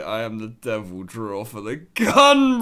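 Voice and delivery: quoting in disbelief. Retold or imitated. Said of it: "Why did man say".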